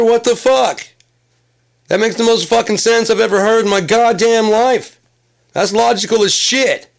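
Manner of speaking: high, loud, exasperated shouting; frantic yelling. Retold or imitated. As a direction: yell it like you're losing your mind with confusion. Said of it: "And I'm like".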